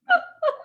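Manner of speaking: a short, very high burst of laughter. Real, not acted. At her co-host's belly button joke, sudden high laugh. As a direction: crack up at the joke.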